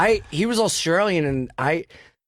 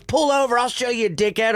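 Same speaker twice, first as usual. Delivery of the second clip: angry threat; 8 semitones higher than usual. Retold or imitated. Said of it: "And he goes".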